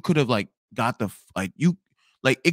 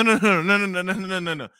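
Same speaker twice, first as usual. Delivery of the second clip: ordinary delivery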